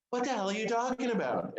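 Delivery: quick, high baffled outburst; mock exasperation. Preacher imitating confused disciples, fast and high. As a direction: play a disciple who understands nothing. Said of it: "the disciples should say".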